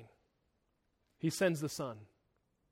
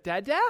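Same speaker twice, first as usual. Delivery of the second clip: "Dada?" with a rising question tune; "Dada?" as a short, upturned query; questioning toddler. Retold or imitated. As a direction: imitate a small child asking "Dada?", lifting at the end. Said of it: "He'll kind of say it as a question".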